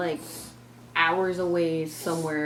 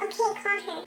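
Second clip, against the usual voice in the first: much higher put-on mom voice; mimicking mom. Narrator imitates her mom asking the driver, high-pitched.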